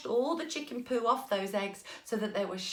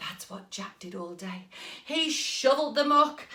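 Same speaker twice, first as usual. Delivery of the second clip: usual speaking voice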